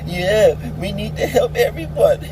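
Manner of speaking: lightly mocking preachy celebrity voice; mocking actor. Mocking Hollywood actors to his viewers, a light put-on voice. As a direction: parody a do-gooder actor, just a touch put-on. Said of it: "stupid actors and actresses that are saying".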